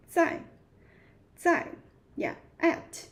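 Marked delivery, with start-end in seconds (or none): 0.1-1.7 在 said with a sharp, straight falling tone. Shown as a demonstration. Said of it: "sharp falling".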